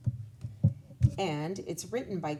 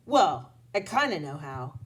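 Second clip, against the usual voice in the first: usual speaking voice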